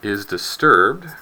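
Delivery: reading a principle out slowly and deliberately, no accent; slow, deliberate textbook recitation; slow formal reading. Shown as a demonstration. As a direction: spell out the law slowly, like a solemn recitation. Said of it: "while I read this".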